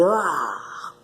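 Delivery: ordinary delivery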